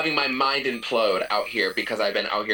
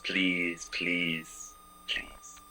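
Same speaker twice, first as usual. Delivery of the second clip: please fading quieter each time; 4 semitones lower, 8 dB quieter, much slower than usual. Pretend. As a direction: let each please sink softer. Pleading in acrostic poem.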